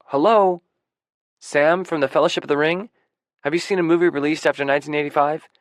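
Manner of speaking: loud, high, incredulous ribbing; incredulous teasing. A narrator's reading. Young friend mocks narrator's film ignorance, incredulous.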